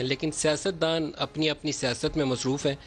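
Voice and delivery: ordinary talk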